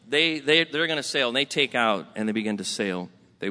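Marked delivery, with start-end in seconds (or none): none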